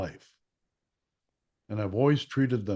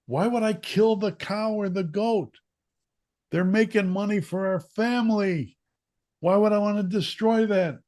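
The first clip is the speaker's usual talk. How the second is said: put-on much higher, louder, indignant kid; upset protest; 11 semitones higher, 5 dB louder than usual. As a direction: protest the cruel idea.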